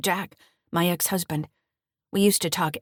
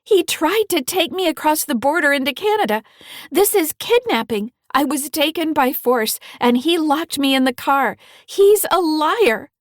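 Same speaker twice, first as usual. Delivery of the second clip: tear-choked; 9 semitones higher, 7 dB louder than usual. A narrator's reading. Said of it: "In a tear-choked voice".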